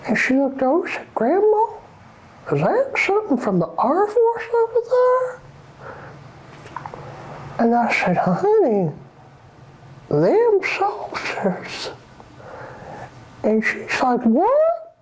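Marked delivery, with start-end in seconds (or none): 1.1-5.4 put-on high little girl voice; hint of a child asking. Grandma voicing child's quote. 8.2-11.9 slow, deliberate grandma reply; slow spooked drawl; slow drawn-out answer. Retold or imitated. 14.2-14.9 high startled what; mock surprised child. Voicing child's surprised question.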